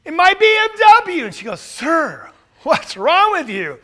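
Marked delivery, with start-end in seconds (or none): 0.0-1.2 high, loud wail over his car; hammed-up distraught cry; mock crying wail. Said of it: "he's out there and he's crying". 1.6-3.8 raised, louder voice of a baffled onlooker; playacted disbelief at his priorities; mock incredulous question. Joke: lady questions crying man.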